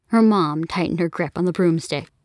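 ordinary delivery

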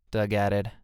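ordinary delivery, nothing marked

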